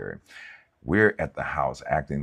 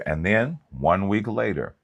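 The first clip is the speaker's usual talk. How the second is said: ordinary talk, nothing marked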